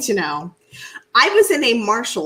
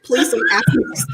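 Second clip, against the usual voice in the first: laughing plea. Real, not acted.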